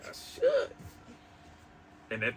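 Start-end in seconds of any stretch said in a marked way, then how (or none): 0.0-0.7 very high, awed gasp of recognition; starstruck. Retold or imitated. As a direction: breathe it out in high, stunned awe. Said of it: "I'm like".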